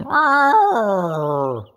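high loud imitated howl; playful dog whine imitation. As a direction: mimic the old dog's cry. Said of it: "my dog Kessel going".

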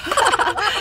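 high burst of laughter; real gleeful cackle; playful laugh. Real, not acted. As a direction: laugh while fooling around. Pushing the baby along in play.

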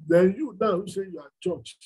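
normal talking voice

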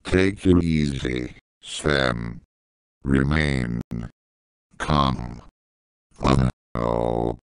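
slow, low, spaced-out words; self-calming. Acted. Boss soothing himself before exploding, slow and low. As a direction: talk yourself down, word by word.

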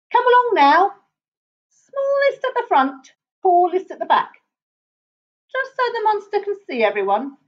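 high, rallying call to the troop; bossy lion leader. A narrator's reading. Lion marshals the animals along.

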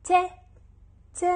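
ت with a short open "a" vowel; short, loud, isolated ت with an "a"; ta with fatha. Shown as a demonstration. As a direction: pronounce ت as a crisp "ta". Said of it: "فتحة حتلفظ".

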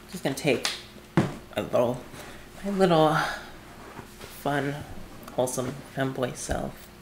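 very soft, halting murmur; wary, hushed. Real, not acted. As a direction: creep forward talking under your breath. Creeping onward, bracing for scare.

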